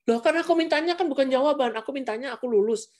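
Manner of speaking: put-on very high, whiny child protest. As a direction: whine it like a disappointed kid. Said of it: "child protesting". (anak merengek, nada sangat tinggi)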